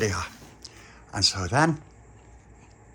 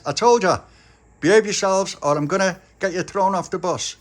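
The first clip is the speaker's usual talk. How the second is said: telling off; 6 semitones higher, 3 dB louder than usual. Acted. Angry passenger telling off.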